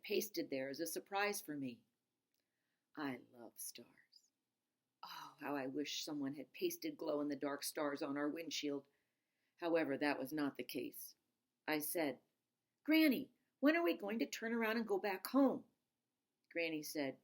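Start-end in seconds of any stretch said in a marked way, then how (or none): 3.0-8.8 dreamy whisper. Acted. Dreamy aside about stars. 12.8-15.6 put-on pleading child.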